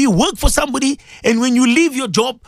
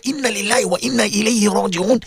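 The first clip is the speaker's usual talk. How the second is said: ordinary delivery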